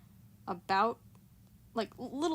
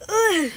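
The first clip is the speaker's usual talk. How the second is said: groan; 12 semitones higher, 17 dB louder than usual. Real, not acted. Forcing herself out of bed.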